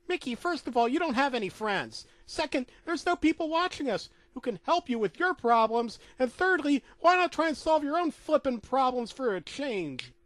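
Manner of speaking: Minnie scolding. Acted. Wife lecturing Mickey, high scolding cartoon voice. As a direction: tick off each point at him. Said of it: "Minnie asked this".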